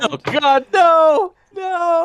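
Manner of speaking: anguished cry. Acted. Reacting to companion's death.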